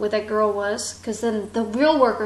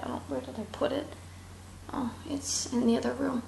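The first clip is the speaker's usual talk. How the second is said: whisper; 2 semitones higher, 10 dB quieter, faster than usual. Real, not acted. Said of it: "unvoiced aside".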